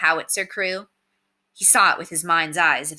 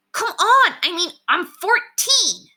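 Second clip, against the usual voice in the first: indignant protest; 13 semitones higher, 6 dB louder, slower than usual. Acted. Girl protesting to Damien.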